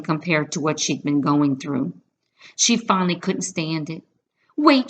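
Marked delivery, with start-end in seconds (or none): 4.5-4.9 high, louder call. A narrator's reading. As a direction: cry out to stop her. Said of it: "she cried".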